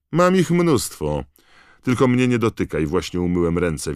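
high, loud, showy disdain; haughty taunt. A narrator's reading. Malfoy to Hermione about his badges, loud disdain. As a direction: boast loudly, then warn her off.

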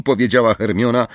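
ordinary delivery, nothing marked

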